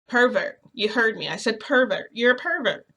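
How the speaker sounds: emphatic accusation. Retold or imitated. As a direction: double down on the insult. Repeats the accusation at him.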